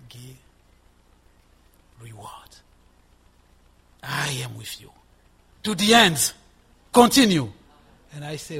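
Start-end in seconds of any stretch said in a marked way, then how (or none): 0.1-4.9 soft, low voice of the gentle Savior; hushed reassurance with long pauses between phrases; gentle whisper. Retold or imitated. Good shepherd reassuring the weary preacher, gentle and hushed. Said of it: "the good shepherd whisper, gentle savior". 5.6-7.5 loud, raised voice urging perseverance; firm exhortation. Retold or imitated. Savior urging the preacher onward, loud firm exhortation.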